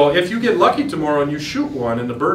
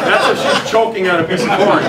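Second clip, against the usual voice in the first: louder and higher, laughing through the words; laughing joke; 4 semitones higher, 5 dB louder than usual. Real, not acted. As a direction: laugh it off after the flubbed call. Jokes after a botched call.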